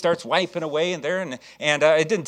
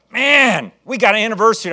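high, loud demo of an eager invite; acted burst of enthusiasm; excited invitation; 6 semitones higher, 10 dB louder than usual. Shown as a demonstration. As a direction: invite them as if it's thrilling news. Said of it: "Boy, you excited about it".

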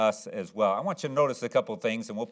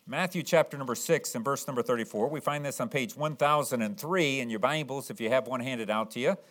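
ordinary talk, nothing marked

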